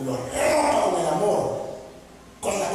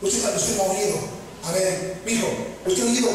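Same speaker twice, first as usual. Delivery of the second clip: ordinary talk, nothing marked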